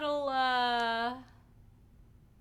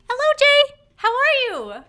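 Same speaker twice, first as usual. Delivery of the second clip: much higher and louder, bright greeting voice; cheery greeting; 11 semitones higher, 13 dB louder than usual. Pretend. Streamer greeting Jay, overjoyed and loud. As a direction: call out a hello like an overjoyed host.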